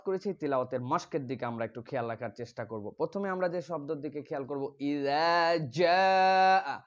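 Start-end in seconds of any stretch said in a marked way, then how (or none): 4.8-6.8 ইযা জাআ drawn out slowly with a long falling জাআ; slow Quranic chant of ইযা জাআ; tajweed recitation. Shown as a demonstration. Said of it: "সবাইকে বলতে হবে".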